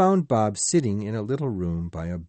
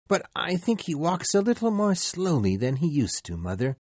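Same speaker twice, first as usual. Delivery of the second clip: put-on higher young son, worried; lightly voiced boy Peter; 9 semitones higher than usual. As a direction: let the boy's worry show gently.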